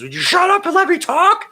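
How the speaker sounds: high, loud yelled impression; Peter Rosenberg. Host imitating the funniest drop, high mock yell. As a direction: shriek it like an exasperated co-host. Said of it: "the funniest Peter Rosenberg drop".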